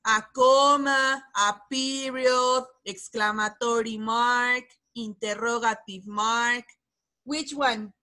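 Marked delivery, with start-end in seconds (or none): 0.1-6.6 slow, clear listing. Shown as a demonstration. Teacher repeats the choices slowly for a struggling student. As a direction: spell out "a comma, a period" slowly so the student can follow. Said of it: "Uh, listen".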